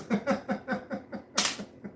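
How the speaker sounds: pained laugh. Real, not acted. Finger hurts after clapping bug.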